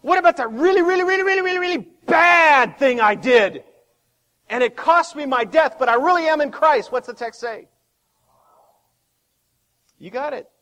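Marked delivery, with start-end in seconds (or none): 0.0-6.9 mock objector. Objector voiced again.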